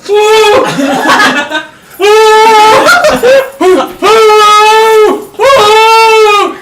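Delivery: loud, high mock wailing; long hooting cries of panic; freaking out. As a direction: imitate panicked howls of whoa. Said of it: "fucking Phil freaks out".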